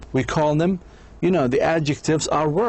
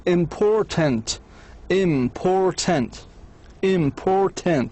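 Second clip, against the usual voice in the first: "important" slowly as im-por-tant, pitched higher; three separate syllables; 4 semitones higher, much slower than usual. Shown as a demonstration. Teacher demonstrates a three-syllable adjective, slow and segmented. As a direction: spell out the beats of "important", slow and even. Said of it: "تتكون من ثلاثة مقاطع".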